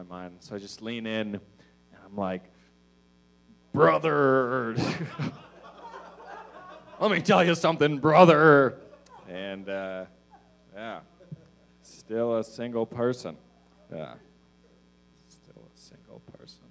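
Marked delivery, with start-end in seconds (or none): 3.7-8.8 put-on "brother" voice. Blurts first thing in put-on voice. 15.3-16.6 very soft, voiceless whisper; barely audible whispered repeat; whispered aside. Real, not acted. Same line repeated as whispered aside.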